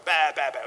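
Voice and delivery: repeated loud mock bleats; imitated baby crying. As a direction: bawl in bursts like a baby. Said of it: "Schreit immer noch". (nachgemachtes Säuglingsgeschrei, laut)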